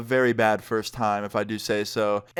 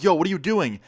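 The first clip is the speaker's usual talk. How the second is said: raised, higher-pitched mock yell; retold yell; 5 semitones higher, 3 dB louder than usual. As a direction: call out in alarm, not at full volume. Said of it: "And I yell out".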